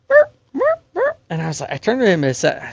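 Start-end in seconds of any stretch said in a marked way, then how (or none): 0.1-1.2 high yipping coyote call; imitated coyote yips. Imitating the coyotes heard from the orchard, high yipping. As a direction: yip it like a distant coyote. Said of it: "we hear this".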